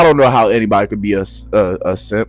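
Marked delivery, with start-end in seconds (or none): none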